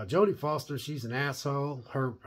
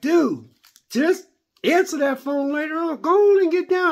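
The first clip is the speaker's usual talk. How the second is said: loud, high-pitched coaxing; exasperated urging; 12 semitones higher, 9 dB louder than usual. Acted. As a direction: urge a buddy on, voice climbing higher and higher. Acted urging of the show's character.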